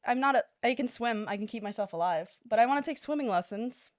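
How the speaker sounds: ordinary talk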